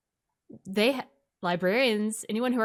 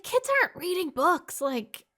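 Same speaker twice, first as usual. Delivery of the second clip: much higher, mock disbelief; incredulous high voice; 10 semitones higher than usual. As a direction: pitch it up in disbelief. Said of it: "I was like".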